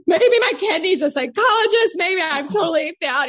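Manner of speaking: hammed-up tearful self-doubt; mock despair. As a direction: lament like a panicked parent, joking. Acts out own parenting panic.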